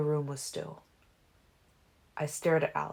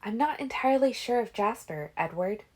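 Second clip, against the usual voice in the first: put-on higher young woman's voice; Alice's voice; 7 semitones higher than usual. A narrator's reading. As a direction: voice her worried warning. Alice warns about Jasper.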